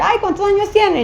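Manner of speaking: high-pitched mock-curious question; imitated surprised curiosity. Woman retelling colleagues asking her age, high and curious.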